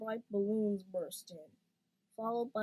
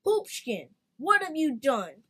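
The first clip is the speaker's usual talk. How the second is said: much louder, stern scolding; 4 semitones higher, 11 dB louder than usual. A narrator's reading. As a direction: scold him like a cross parent. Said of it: "spoke in a stern voice".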